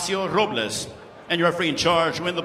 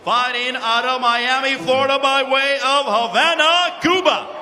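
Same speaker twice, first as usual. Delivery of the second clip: lucha libre announcer; 5 semitones higher than usual. Acted. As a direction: stretch the names, hype the crowd. Ring announcer, Spanish intro.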